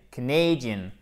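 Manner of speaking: Canadian with a stressed A diphthong as second syllable. Shown as a demonstration. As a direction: say the middle of Canadian like the English letter A. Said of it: "comme la diphtongue A".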